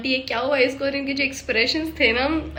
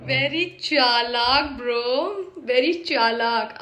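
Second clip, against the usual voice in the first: slow mock drawl; much slower than usual. Mocking the suitor, slow and teasing. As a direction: drag out very चालाक slowly, teasing. Mocking a drama character's line.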